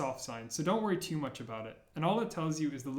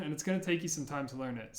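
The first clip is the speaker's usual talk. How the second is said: ordinary talk, nothing marked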